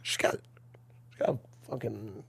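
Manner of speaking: hushed low whisper. Real, not acted. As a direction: drop to a secretive whisper. Confiding about the date.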